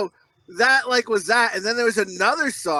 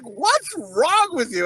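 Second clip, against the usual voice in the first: exasperated wife; 4 semitones higher, slower than usual. Retold or imitated. Voices wife scolding him.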